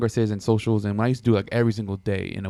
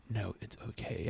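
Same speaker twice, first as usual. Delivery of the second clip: much softer, curt brush-off; a quiet, chilly "it's okay"; cold soft dismissal; 2 semitones lower, 16 dB quieter than usual. Retold or imitated. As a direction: say it almost under breath. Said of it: "she's like".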